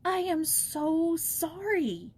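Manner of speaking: put-on a child earnestly comforting an old woman; heartfelt. A narrator's reading. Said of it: "exclaimed Joyce with such heartfelt earnestness".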